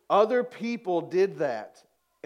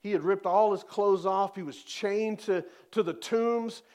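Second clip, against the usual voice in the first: normal talking voice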